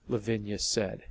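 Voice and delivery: plain reading voice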